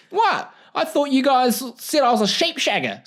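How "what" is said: ordinary talk, nothing marked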